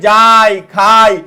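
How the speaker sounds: যাই and খাই drawn out long and slow; slow and stretched. Shown as a demonstration. Teacher slowly voicing example words for students. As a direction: lengthen each word slowly. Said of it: "তাহলে ভাই".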